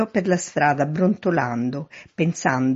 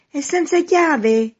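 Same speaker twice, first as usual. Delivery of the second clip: high, loud, drawn-out call; shouting to be heard; 13 semitones higher, 7 dB louder, slower than usual. A narrator's reading. Man at third-floor window to someone in the street, shouting. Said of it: "chiese gridando per farsi sentire".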